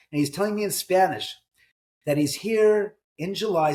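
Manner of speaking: usual speaking voice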